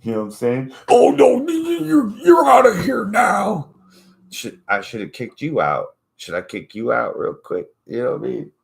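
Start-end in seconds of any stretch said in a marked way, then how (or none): 0.9-3.7 very high, loud, wailing squeal; put-on squealing voice, stretched at the end; mock hysterical ejection. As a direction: shriek it like an outraged host throwing someone out. Mocking panel kicking someone out.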